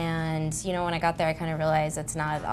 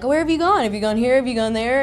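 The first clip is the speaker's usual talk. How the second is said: higher, bright-voiced chatty cabbie questions; chatty driver; 6 semitones higher, 6 dB louder than usual. Imitating the chatty Paris cab driver, higher and eager. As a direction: rattle off the questions like a chirpy cabbie. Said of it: "this chatty Kathy is just talking".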